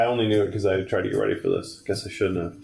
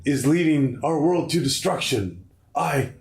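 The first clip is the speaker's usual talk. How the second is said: lightly hammed-up high plea; dramatic game character; 6 semitones higher than usual. Gamer reading game dialogue aloud, mildly melodramatic voice. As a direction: declaim it higher, like a doomed warning. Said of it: "I guess we gotta do voices".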